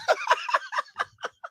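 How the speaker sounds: shrill dropped-in cackle; high cackling laugh. Acted. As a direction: cackle like a sound effect. Laugh effect after quote.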